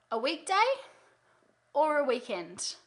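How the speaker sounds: weekday lifted up, weekend dropped down; rising then falling choice. Shown as a demonstration. Coach demonstrating the hostess question, rise then fall. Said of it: "voice goes down".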